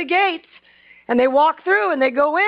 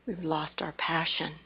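much softer, slow lament; hushed; 7 semitones lower, 14 dB quieter than usual. Acted. Preacher lamenting to church, soft and slow. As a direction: drop it to a near hush.

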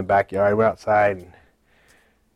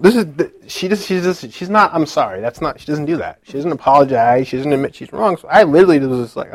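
normal talking voice

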